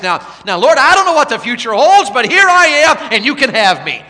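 high, loud, ringing revival preaching; full-force preaching. Acted. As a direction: drive the gospel call home to the crowd.

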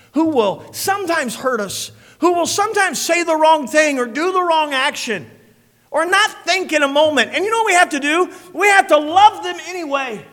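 loud, high, full-force preaching. Acted. Preacher proclaiming God's glory to the church, full-force preaching. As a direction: thunder it out to the congregation.

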